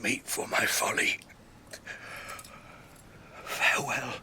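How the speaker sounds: faint, breathy dying words; fading breath, pushing out each word; dying whisper. Acted. Boromir dying in Aragorn's arms, whispering.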